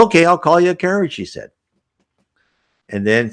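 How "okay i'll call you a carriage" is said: much higher, quick, breezy agreement; humoring him. Retold or imitated. Spirit helper agrees to call him a carriage, humoring him. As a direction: play along with the bossy man. Woman humours the bossy man.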